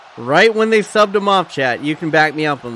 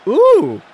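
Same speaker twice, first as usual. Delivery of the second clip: high-pitched whoop; quick high "ooh" that drops away; reacting "ooh"; 10 semitones higher, 4 dB louder than usual. Real, not acted. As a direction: let out a startled high hoot. Live reaction to players' exchange.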